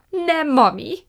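high, loud bellow. A narrator's reading. Prue corrects Fan néni, bellowing. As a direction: roar it as if to someone dim. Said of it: "bömbölte Prue".

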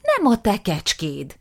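slow, firm refusal; cold retort. Acted. Aftab refusing his brother, slow and firm. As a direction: lay it down slowly.

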